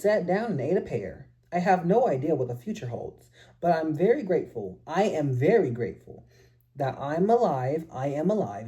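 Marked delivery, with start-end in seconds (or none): none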